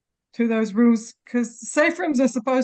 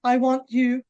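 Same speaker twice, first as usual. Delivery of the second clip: usual speaking voice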